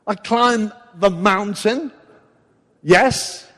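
loud, slow calls up the mountain; impatient Moses. Retold or imitated. Moses calling to God from the mountaintop, loud and impatient. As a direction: call out impatiently, slowly. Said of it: "if I was Moses".

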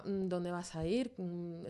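normal talking voice

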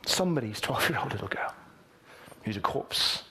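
whispered, grave and hushed. Real, not acted. Pastor whispers to the congregation about the dead girl.

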